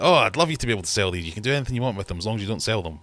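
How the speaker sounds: fast, eager mock enthusiasm; gushing designer. Key ring designer happily contradicting himself, fast and eager. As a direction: rattle it off like an eager, cheerful designer. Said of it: "And he's like".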